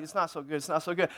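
low, hushed audience grumble; unimpressed murmur. Retold or imitated. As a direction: imitate a crowd quietly unconvinced. Mimics unimpressed audience reaction.

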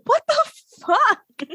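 high, slow startled gasp of a line; mock shock, drawn out high; shocked what the fuck. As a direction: jump way up in pitch, stunned.

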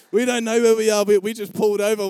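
high, very loud outburst; retold loud exclamation. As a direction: shout back that you are lost, imitated. Retold panicked answer to the caller.

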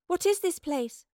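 put-on high-pitched cry of a girl. A narrator's reading. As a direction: cry out the question, pitched well up. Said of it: "cried Mildretha".

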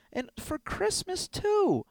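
high, drawn-out lament; wounded whine. Acted. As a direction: whine it slowly in dismay. Upset at being blackmailed.

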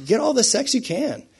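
high-pitched mock cajoling; cajoling party-goer. Worldly friend urging a believer to party, cajoling. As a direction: push it with a high, pleading lilt. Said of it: "look at you and be like".

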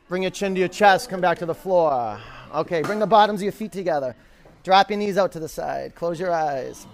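loud, driving yoga class cues; coaching call. Acted. Instructor driving a yoga class hard. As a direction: push the room through the pose.

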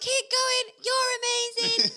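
high-pitched rallying shout; loud re-enacted cheering. Cheerleader re-enacts urging runners on the last mile, loud cheering. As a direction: urge the runners on at a yell. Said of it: "this is really loud".

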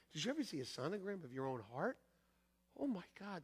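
ordinary delivery

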